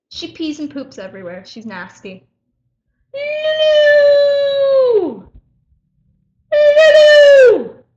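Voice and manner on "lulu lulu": high loud shout across room; calling out. Real, not acted. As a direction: shout for the dog.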